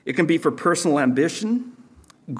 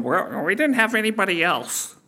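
higher, whiny fake excuse; mock excuse; 5 semitones higher than usual. Preacher mocking the elites' excuse. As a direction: sneer it as a feeble excuse.

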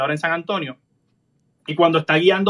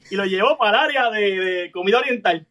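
ordinary delivery

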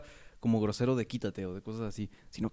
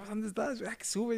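much higher, impatient mother's call; mom calling; 10 semitones higher, 3 dB quieter, slower than usual. Retold or imitated. Mother ordering her son to come upstairs, high and fed up. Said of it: "y ya me dice".

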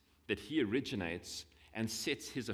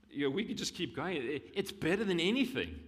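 rising excitement; 6 semitones higher, 5 dB louder than usual. Real, not acted. Preacher building to climax.

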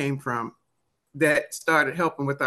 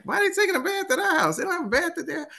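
put-on high, fast, pleading child; whiny kid voice; 14 semitones higher, faster than usual. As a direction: squeak out the question like a nosy kid. Retold child questioning mother.

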